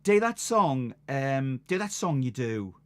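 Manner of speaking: broad Sunderland lass asking; light Sunderland accent. Retold or imitated. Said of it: "this is the Sunderland accent".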